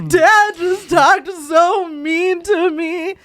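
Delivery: high, loud, drawn-out mock wail; hammed-up hurt kid, slow and high; whining child. Child complains that dad was mean, slow high-pitched whine.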